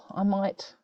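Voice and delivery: usual speaking voice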